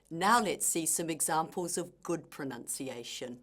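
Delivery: normal talking voice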